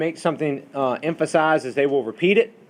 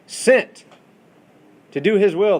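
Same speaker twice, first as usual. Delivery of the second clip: SENT punched high and loud, then a pause; emphatic word order; 7 semitones higher, 6 dB louder, slower than usual. Shown as a demonstration. Teacher reading Greek word order aloud, stress on Sent. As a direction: hit "Sent" hard and high, keep "to do his will" raised.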